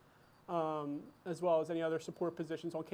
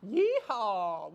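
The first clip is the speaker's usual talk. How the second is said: cowboy yeehaw; 5 dB louder than usual. Acted. Cowboy-themed host cheering.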